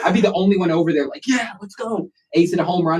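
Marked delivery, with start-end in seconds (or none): 1.3-2.1 re-enacted hype. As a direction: mimic a lone fan hyping up. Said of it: "trying to get hype".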